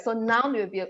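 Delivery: ordinary talk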